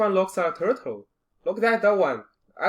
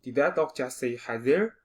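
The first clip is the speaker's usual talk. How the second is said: usual speaking voice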